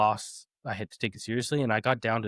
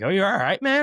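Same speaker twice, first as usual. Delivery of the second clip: raised, louder voice of a checking onlooker; mock concern; 9 semitones higher, 6 dB louder than usual. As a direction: imitate someone checking on a winded friend.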